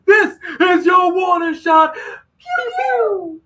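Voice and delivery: high, loud, slow hammed-up shout; mock warning shot. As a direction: fire it off like a cartoon threat. Reading a chat warning shot.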